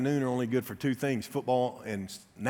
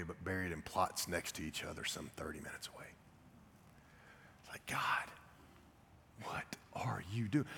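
awed whisper; 6 semitones lower, 15 dB quieter than usual. Retold or imitated. Pastor realizing both babies lie in one cemetery, whispering. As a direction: whisper it in disbelief.

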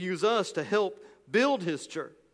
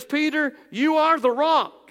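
higher and louder, ringing out "Peter, you are the rock."; proclaiming; 8 semitones higher, 7 dB louder than usual. Acted. Preacher voicing Jesus to Peter, loud proclamation. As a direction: call it out across the church.

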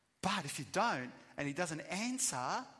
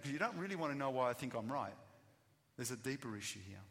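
normal talking voice

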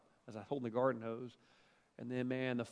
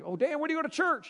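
greeter's voice; 12 semitones higher, 5 dB louder than usual. Retold or imitated. Church greeter questioning dad.

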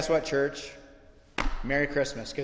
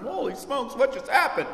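higher, startled exclamation, hammed-up; mock astonishment; 8 semitones higher than usual. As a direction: gasp at the mummy, jokingly. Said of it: "That was the sinful preacher".

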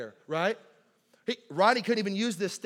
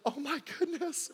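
put-on higher, breathless girlish gasp; mock girl squeal; 8 semitones higher, 5 dB quieter than usual. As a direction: imitate the giggling girls. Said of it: "All the girls like".

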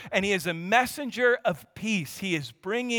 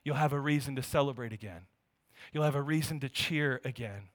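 preaching; 5 semitones lower, 4 dB quieter than usual. Acted.

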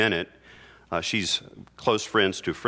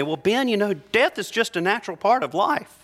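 higher, mocking sing-song comforter; mock platitude; 10 semitones higher than usual. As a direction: parody a patronizing bedside visitor. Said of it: "pat me on my hand ... and say".

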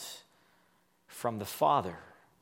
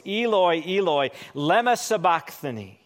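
higher, louder anguished cry; 3 semitones higher, 4 dB louder than usual. Acted. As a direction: call it out in anguish. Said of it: "Jesus cried out with a loud voice".

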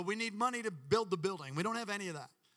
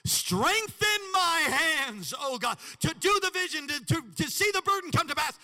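fervent prayer; 10 semitones higher, 10 dB louder than usual. Real, not acted. Pastor leading the congregation to pray, shouted. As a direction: cry out the prayer with full force. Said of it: "Say that".

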